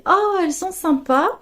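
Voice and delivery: high, bright-eyed oh, elles sont sympas; gushing over earrings at the stall; delighted oh. Retold or imitated. Woman admiring earrings at a market stall, delighted.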